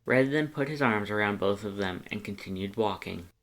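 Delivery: usual speaking voice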